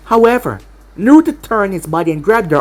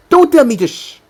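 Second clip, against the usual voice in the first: indignant retort; 5 semitones higher than usual. A narrator's reading. She snaps back at being shushed.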